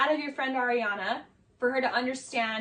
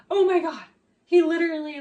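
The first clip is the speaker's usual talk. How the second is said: higher, louder imitated gushing; mock amazement; 4 semitones higher, 7 dB louder than usual. Friend retells her breakthrough with him, in mock amazement. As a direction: mimic an overwhelmed friend. Mimicking friend's emotional reaction.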